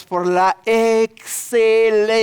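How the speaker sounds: "excelencia" stretched long and loud, syllable by syllable; swelling, sustained emphasis on "excelencia"; drawn-out EXCELENCIA. Real, not acted. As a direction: hold the vowels of "excelencia" like a proclamation. Preacher stretches the key word.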